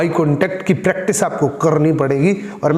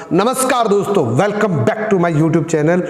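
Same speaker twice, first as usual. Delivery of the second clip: bright-toned, lifted channel welcome; energetic greeting; slower than usual. Shown as a demonstration. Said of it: "मैंने इसको इस तरीके से बोला".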